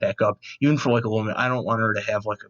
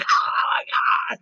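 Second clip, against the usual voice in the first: slow, strained squeal of delight; mock fan scream; much slower than usual. Fan spotting a monkey lizard, drawn-out mock scream. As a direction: drag out an overexcited fan squeal.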